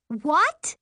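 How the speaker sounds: high, loud startled question; shocked cry. A narrator's reading. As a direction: cry out in disbelief. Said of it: "Miri cried".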